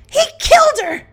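high, loud frantic shriek; shrieked accusation. A narrator's reading. Elsa accusing a guest before the townsfolk, shrieking. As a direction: hurl the accusation at the crowd.

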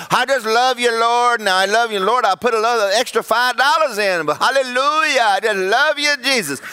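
mock showy praise. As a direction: belt out praise to dodge the point. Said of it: "you're over there going".

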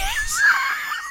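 squealing burst of laughter; high shrieking laugh. Real, not acted. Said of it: "I'm crying of laughter".

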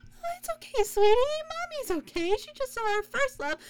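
put-on high, soft soothing to a toddler through tears; calm the little girl down; mother soothing her daughter through tears, soft and high; reassuring mom voice. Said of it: "explain why tears ... to your husband and your two-year-old daughter".

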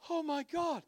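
high-pitched appalled gasp of words; mock dismay. Truck owner seeing the muddy dog inside, high horrified squeak.

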